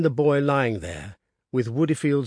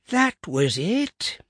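put-on old man recalling; 7 semitones higher, 3 dB louder, much slower than usual. A narrator's reading.

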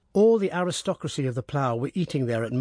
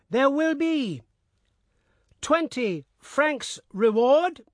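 high, slow crier voice, pausing in odd places; lurching speed changes with wrong pauses; jerky town crier; 12 semitones higher, slower than usual. Acted. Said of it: "he called forth in a jerky voice, pausing in the wrong places".

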